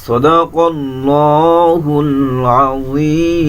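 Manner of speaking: slow, drawn-out tajweed chant; measured Quranic recitation, each verse held. Shown as a demonstration. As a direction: recite with long melodic stretches. Teacher recites surah in tajweed.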